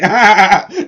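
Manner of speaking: loud burst of laughter; hearty laugh. Real, not acted. Pitchman laughing loudly at his own wordplay.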